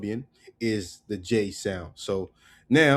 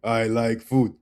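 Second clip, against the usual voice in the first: loud, slow, dumb caveman; 5 dB louder, slower than usual. Pretend. Teacher mocks a caveman saying todo. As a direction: lumber it out like a caveman. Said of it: "Goddamn caveman".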